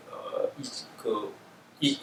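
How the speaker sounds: ordinary talk, nothing marked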